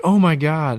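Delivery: imitating the friend's shocked reaction; stunned gasp of words. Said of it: "he was like".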